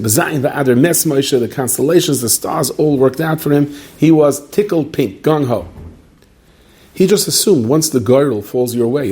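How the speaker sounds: normal talking voice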